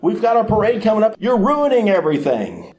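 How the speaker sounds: high, squeaky, flustered mock Pharisee voice; panicky Pharisees. Pharisees panicking as the parade nears, in a high squeak. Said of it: "the Pharisees are going".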